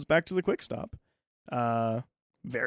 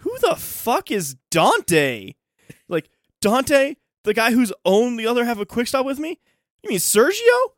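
much higher and louder, slow, disbelieving stoner; excited stoner Jay; 10 semitones higher, 8 dB louder than usual. Retold or imitated. Stoner Jay baffled by Randall's request, much higher, louder, slow voice.

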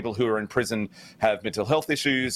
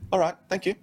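higher, mock-stiff thank you; mimicking the thank you; 8 semitones higher, faster than usual. Co-host parroting the host's odd thanks, mock-stiff. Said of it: "It was".

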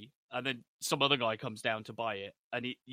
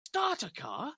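incredulous echo; 13 semitones higher, slower than usual. Retold or imitated. Dennis outraged at the buyer.

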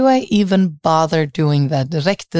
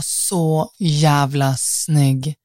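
dreamy; 2 semitones lower, much slower than usual. Real, not acted. Dröjer vid hur snygg han var.

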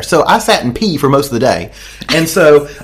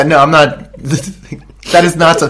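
usual speaking voice

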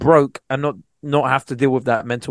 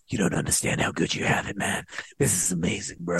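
whispered, awestruck; hushed amazement; 9 dB quieter than usual. Retold or imitated. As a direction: whisper it like you can't believe your luck. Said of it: "going into Sainsbury's like".

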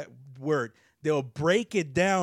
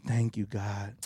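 put-on old school prayer murmur; 8 semitones lower, 4 dB quieter, slower than usual. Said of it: "you hear 'em going like".

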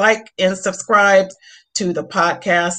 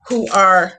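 usual speaking voice

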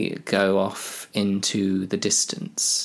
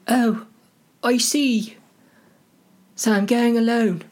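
high, halting put-on voice; comic hobbit; 14 semitones higher, 4 dB louder than usual. Hobbits discuss going alone, high character voice.

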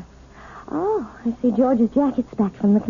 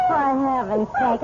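ordinary delivery

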